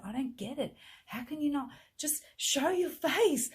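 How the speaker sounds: rising exasperation, nearly shouting; retold frustration as she confronts the boyfriend on a call; frustrated outburst. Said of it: "I'm so frustrated".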